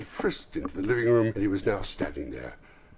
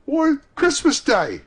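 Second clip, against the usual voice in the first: put-on high, loud, slower boyish answer; boy's voice; 12 dB louder, slower than usual. A narrator's reading. Boy telling Scrooge the day, astonished. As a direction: call back as an astonished lad. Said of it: "replied the boy".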